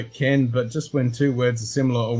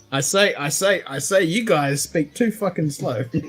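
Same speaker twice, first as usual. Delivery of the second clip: Foghorn Leghorn impression; 5 semitones higher than usual. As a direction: ham up a blustering cartoon rooster. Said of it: "Foghorn Leghorn".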